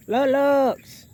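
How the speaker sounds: high, loud call across the water. Real, not acted. Feeding geese at the pond, calling a bird over loud and high. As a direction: sing out the name to get its attention.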